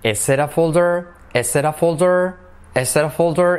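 "it" said like "er" with a tapped r; soft R for T. Shown as a demonstration. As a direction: flap the t in "it" into a soft Spanish r. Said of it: "La T se convierte en una R suave".

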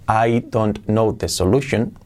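slow "I don't know the solution", word by word; textbook English. Shown as a demonstration. Teacher shows what your brain has stored, textbook "I don't know the solution". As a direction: separate every word, full "don't". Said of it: "Si en tu cerebro tienes".

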